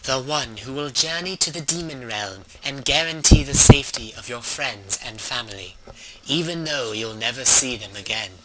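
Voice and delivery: dramatic fantasy narrator voice; story narration. Acted. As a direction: read like an epic tale.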